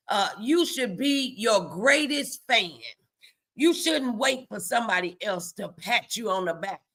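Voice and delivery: ordinary talk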